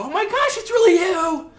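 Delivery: high, loud fangirl squeal; really excited girl. Acted. Pitching Squirrel Girl meeting Iron Man, really excited. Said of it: "you hear a female voice go ... And really excited".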